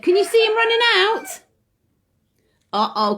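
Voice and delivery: usual speaking voice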